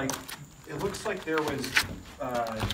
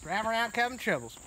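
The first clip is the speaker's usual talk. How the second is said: put-on hillbilly accent; 7 semitones higher than usual. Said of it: "talk like a hillbilly".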